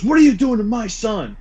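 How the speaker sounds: high-pitched mock indignant mom. Mom confronting a kid over her son, indignant. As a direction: imitate an outraged mother confronting someone.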